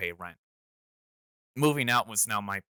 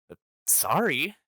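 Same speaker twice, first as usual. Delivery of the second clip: apologetic; 7 semitones higher, 5 dB louder than usual. Retold or imitated. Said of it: "I apologized".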